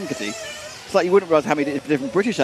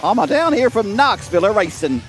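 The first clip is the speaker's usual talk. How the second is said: Knoxville accent; 5 semitones higher than usual. Shown as a demonstration. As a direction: put on a lilting Tennessee Southern accent. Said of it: "hear my Knoxville accent again".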